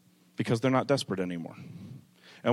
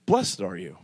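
gentle blessing; 3 semitones lower than usual. Acted. Jesus gently blessing the mourner.

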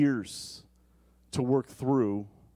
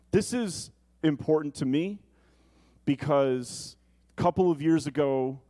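normal talking voice

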